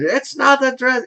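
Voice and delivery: high, strained imitation of a screamed retake; mock Scottish scream. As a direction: squeal it like a hammed-up Scottish kiltsman. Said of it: "he would be screaming into this little microphone".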